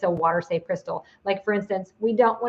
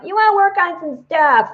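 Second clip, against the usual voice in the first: high, louder playful taunt to the crystal; teasing challenge; 10 semitones higher, 7 dB louder than usual. Retold or imitated. Self-quote taunting the crystal in the tub, playful and high. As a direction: tease it like a dare. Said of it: "So I'm like".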